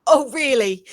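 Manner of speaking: ordinary talk, nothing marked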